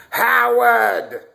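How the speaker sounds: high, loud call from the next room; Howard's offstage mother. Retold or imitated. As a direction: yell for your son from offstage. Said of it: "you just hear that voice".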